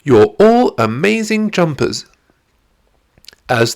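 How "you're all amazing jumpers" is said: high, beaming praise; cheering. Acted. Zoe praising the cheetahs, bright high cheer. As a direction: gush over their jumping. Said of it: "Zoe cheered".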